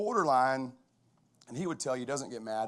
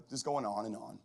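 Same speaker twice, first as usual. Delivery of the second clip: ordinary talk, nothing marked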